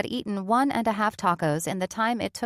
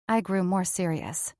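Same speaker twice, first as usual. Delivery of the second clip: ordinary delivery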